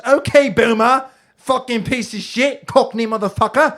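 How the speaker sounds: mock-posh British accent, snooty; pompous British voice. Joking with his friend, a hint of pompous British accent. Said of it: "in a pompous British voice".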